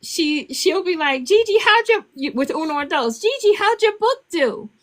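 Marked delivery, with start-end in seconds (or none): 1.2-2.1 put-on child voice. Granddaughter asks about book. 3.2-4.7 put-on child voice. Granddaughter asks about book.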